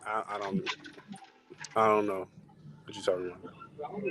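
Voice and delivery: mock evasive mumble; mumbling. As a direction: trail off evasively. Joking evasion.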